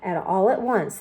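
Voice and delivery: ordinary delivery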